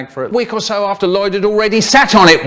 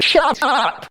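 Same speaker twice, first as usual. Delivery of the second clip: mock shout; 11 semitones higher than usual. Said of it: "so I said".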